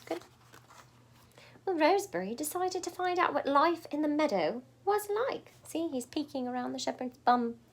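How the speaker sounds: usual speaking voice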